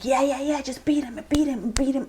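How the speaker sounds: watching an action movie, caught up in the fight, cheering. Retold or imitated. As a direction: urge the fighter on from the couch. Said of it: "I'm just like".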